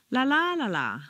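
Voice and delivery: "La, la, la, la" peaking high on the second la, then falling; stress on second LA. Shown as a demonstration. Teacher drills a syllable pattern, stress on second "la". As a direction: lift the second "la", step down after it. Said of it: "syllable count intonation patterns".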